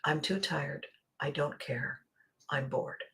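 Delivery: bored and listless. Retold or imitated. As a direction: mumble like you can't be bothered. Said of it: "waking up and saying".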